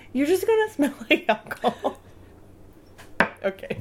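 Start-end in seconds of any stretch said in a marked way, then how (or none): 0.1-2.0 mock scolding through a laugh. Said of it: "I'm like".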